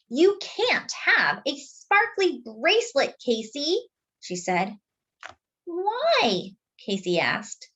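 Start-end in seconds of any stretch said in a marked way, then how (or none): 0.1-3.9 high, slow, bossy refusal; firm big sister. Acted. Sister refuses her brother the skirt, slow and bossy. 5.6-6.5 put-on innocent toddler. Toddler asks his sister why, high and small.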